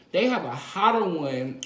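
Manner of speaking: ordinary delivery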